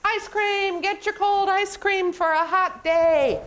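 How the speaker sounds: high, sing-out street seller's cry; penguin ice cream vendor. Acted. Penguin selling from the ice cream cart, calling out to customers. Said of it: "And the penguin says".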